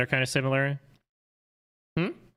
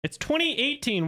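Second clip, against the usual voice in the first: indignant correction. Real, not acted. Indignant at a chatter's trick question.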